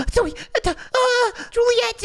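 high, gasping panic; panicked blurting. Acted. Spy almost caught by Zoe, panicked high stammer. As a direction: stammer and squeal as if nearly caught.

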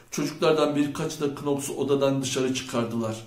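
ordinary delivery

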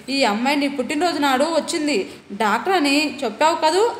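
usual speaking voice

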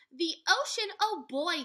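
high, loud and delighted; thrilled. A narrator's reading. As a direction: gasp out your excitement. Annie thrilled by the ocean.

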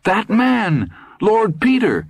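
he can't believe who he sees; shocked shout. A narrator's reading. Ed still shouting at headline.

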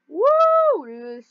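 high, loud, drawn-out cheer; excited woo. Real, not acted. Player cheering at the end of the video, excited whoop. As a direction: let out a big high whoop.

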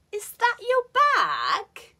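very high, slow, BAG dips then rises; exaggerated high fall-rise on "bag"; polite fall-rise question. Shown as a demonstration. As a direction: stretch "bag" into an upward swoop. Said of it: "this intonation is supposed to make questions sound".

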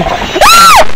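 very high loud scream; terrified shriek. Real, not acted. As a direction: scream at a jump scare. Jump scare.

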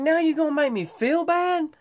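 high-pitched mock hurt protest; high mock indignation. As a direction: squeak it out, pretending to be wounded. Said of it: "I was like".

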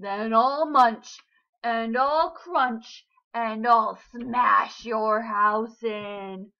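loud, slow roaring shark. A narrator's reading. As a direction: roar each threat slowly. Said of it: "roared the shark".

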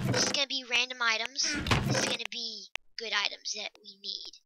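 usual speaking voice

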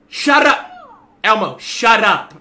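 loud angry yell at a toy; shouting. Real, not acted. Yelling at the Elmo toy.

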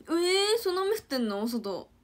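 loud high surprised question; real surprise at the rain. Real, not acted. (驚いて大きく高い声で)

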